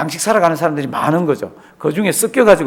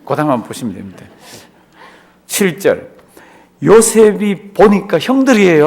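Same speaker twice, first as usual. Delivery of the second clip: ordinary delivery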